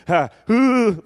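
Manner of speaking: high, loud imitated gasp of fright; comic jump-scare yelp; startled yelp. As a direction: act out a double startle. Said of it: "when you don't expect to get scared".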